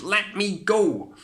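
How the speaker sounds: high, loud, slow shout. A narrator's reading. Cindy shouts at the prince grabbing her dress. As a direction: pull free and shout. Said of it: "As Cindy shouted".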